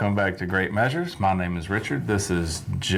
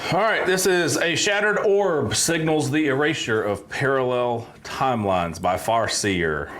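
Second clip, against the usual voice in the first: announcer voice; 6 semitones higher than usual. Acted. Announcing the song.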